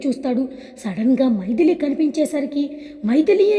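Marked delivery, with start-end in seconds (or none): 3.1-3.6 much higher and louder, a shocked gasp of her name; stunned cry of recognition; startled cry. A narrator's reading. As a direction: blurt her name in disbelief on sight. Suddenly sees her through glass.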